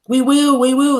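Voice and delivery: high, loud grinding chorus; witch chorus. Acted. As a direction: answer like a chorus of dentist drills.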